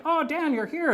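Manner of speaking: imitating friends greeting him at the pub. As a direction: squeal it out like a friend spotting you.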